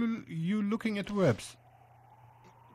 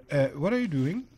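ordinary delivery

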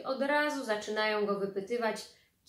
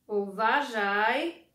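slow and louder, weighty; stern warning; 6 dB louder, much slower than usual. Acted. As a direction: warn the boy sternly. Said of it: "pogroziła Bartusiowi palcem". (ciotka surowo ostrzega Bartusia)

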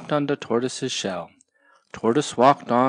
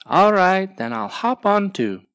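higher, louder bird voice; Sparrow agreeing; 8 semitones higher, 5 dB louder than usual. Acted. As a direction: agree brightly.

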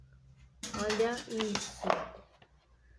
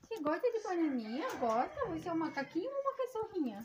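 high, soft teasing coo; baby talk to dog; 7 semitones higher, 6 dB quieter than usual. Acted. Owner asking her dog if it's a monkey, high baby talk. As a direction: tease the puppy playfully.

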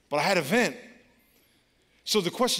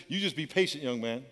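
gentle God; 6 semitones lower, 6 dB quieter than usual. Retold or imitated. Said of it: "he says".

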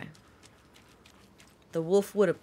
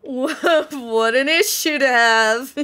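laughing; 6 semitones higher, 9 dB louder, slower than usual. Real, not acted. Laughing at own remark.

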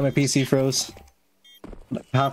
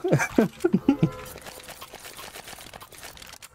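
high airy giggle; breathy laugh; 10 semitones higher, 5 dB quieter than usual. Real, not acted. Laughing after booing viewers.

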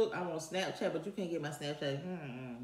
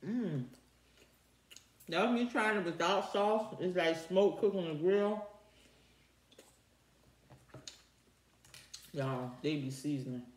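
speaking between bites; chewing while talking. Real, not acted. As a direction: mouth partly full of food. Seen 1: Bit chicken at -5; cheeks and lips keep chewing while speaking.

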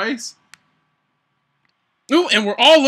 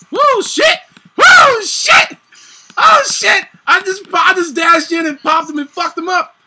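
high, loud whooping triumph; yelled out, thrilled at the play; excited whoop; 10 semitones higher, 8 dB louder, slower than usual. Real, not acted. Streamer cheering his own play, high, loud whoops. Wins a fight.

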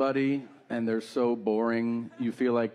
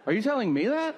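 high, disbelieving question; can't believe he's telling you this; mock outrage at an overshare; incredulous; 8 semitones higher, faster than usual. Incredulous reply to stranger.

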